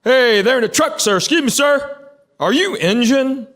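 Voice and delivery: high, strained call from a stranger; mock yell across the gas pumps. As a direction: imitate a man hollering at a gas station. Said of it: "he starts yelling at me".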